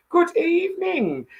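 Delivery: high, put-on posh "good evening"; greeting Ian with a lightly done posh lilt. Host greeting a viewer in a mock posh voice. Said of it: "Very posh".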